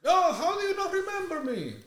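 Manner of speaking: very high, pleading mock Mexican accent; Mexican caller. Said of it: "what happens from Mexico".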